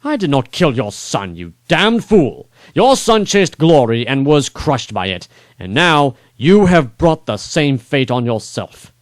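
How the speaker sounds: king condemning the dying lord; stern rebuke. Acted. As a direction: hold him by the hair and lecture.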